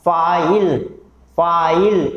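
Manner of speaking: "फाइल" drawn out for repeating, ending on L; pronunciation model. Shown as a demonstration. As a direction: say "फाइल" clearly so students can copy it. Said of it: "फिर से pronounce कीजिए आप". ("फाइल" दोहराने के लिए साफ़ उच्चारण)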